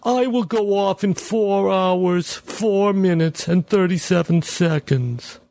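high, slow machine voice; measured, robotic countdown; talking bomb. Acted.

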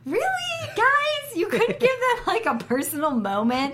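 high, incredulous "really guys"; exasperated disbelief. Retold or imitated. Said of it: "I'm like".

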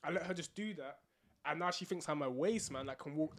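ordinary delivery

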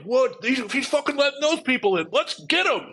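high-pitched, loud mock outrage from the crowd; mock angry crowd. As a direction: play the furious mob, shrill and loud. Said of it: "are like".

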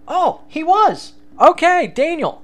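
high, loud, startled gasp of discovery; shocked realisation. Real, not acted. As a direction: blurt it out as the truth hits you.

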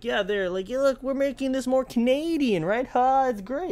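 high, loud mocking smug voice; mock smug localizer. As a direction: parody the localizer bragging. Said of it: "and be like".

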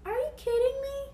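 high retold disbelief at the crash; shocked disbelief. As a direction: squeak it out in disbelief. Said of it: "I was like".

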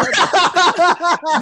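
high, loud laugh breaking out; loud burst of laughter. Real, not acted. Laughs at own story. (tertawa keras dan tinggi)

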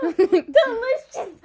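high, slow, laughing delight; laughing mock delight. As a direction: savor it through a laugh. Joking about eight-legged pie.

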